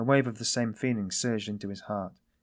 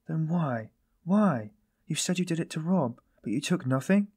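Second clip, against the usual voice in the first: high-pitched urgent questioning; quick, grasping questions; 7 semitones higher, 3 dB louder than usual. A narrator's reading. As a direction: press for an answer, pitch raised. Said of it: "she asked quickly, catching at a straw".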